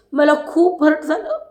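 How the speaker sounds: imitating hurt, pitch climbing; Deepali's hurt plea. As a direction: lightly act out wounded feelings. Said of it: "असं ती म्हणत होती दीपाली".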